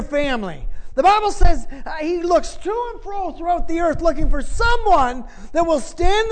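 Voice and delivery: high, loud, insistent sermon delivery; full-force preacher's exhortation; forceful preaching. Real, not acted. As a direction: drive it home to the congregation.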